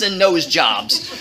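ordinary delivery

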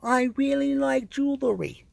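a touch of the mumbled impression; mocking quote. Quoting the documentary man in a light mock voice. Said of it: "here's what he said".

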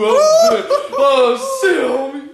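very high, loud whoop through laughter; excited swooning. Real, not acted. Gushing to friend over a girl's thick accent, ecstatic howl. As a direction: howl with delight, easing slightly by the end.

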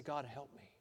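soft, low plea to God; quiet prayer. Acted. Believer prays in a moment of need, quiet plea. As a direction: pray it softly and humbly. Models a humble prayer for help.

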